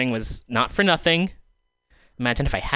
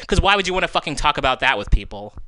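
ordinary talk, nothing marked

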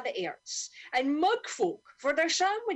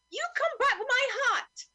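high, loud scolding; angry cry; 10 semitones higher, 4 dB louder than usual. Acted. Salvation Army dame scolding the gull that stole her hat, a high loud cry. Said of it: "she cried".

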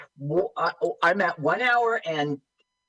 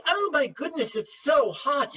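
high breathy mock starlet voice; imitating Bianca Jagger; 10 semitones higher than usual. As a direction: squeal it like a swooning starlet. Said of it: "Bianca Jagger goes".

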